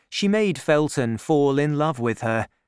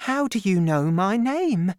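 high, startled question; surprised young woman; 8 semitones higher than usual. A narrator's reading. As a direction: sound taken aback. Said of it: "she asked".

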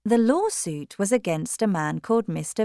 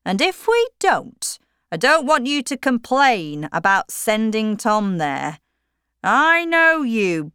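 a lightly voiced stubborn father, a bit higher; firm, set on his plans; Mr Tulliver's voice; 6 semitones higher, 5 dB louder than usual. A narrator's reading. Husband chides his wife.

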